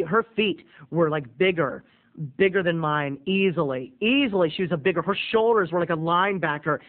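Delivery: ordinary talk, nothing marked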